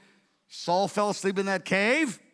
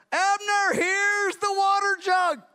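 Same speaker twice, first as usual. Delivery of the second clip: high, loud, slow hail with a changed voice; David shouting to Abner across the valley; taunting call; 11 semitones higher, 3 dB louder, slower than usual. Retold or imitated. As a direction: call out to a rival far away.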